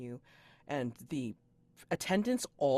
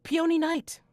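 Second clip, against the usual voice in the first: high, loud piping announcement; piping attendant; 11 dB louder than usual. Acted. Attendant announcing her lady's name, high and loud. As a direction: pipe up like an eager servant. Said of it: "one of the attendants pipes up".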